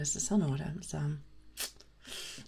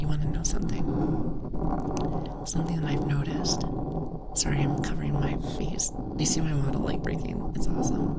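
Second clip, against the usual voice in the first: loud close-mic whisper; 4 dB louder than usual. Real, not acted. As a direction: lean in and whisper a secret. ASMR streamer confiding to chat.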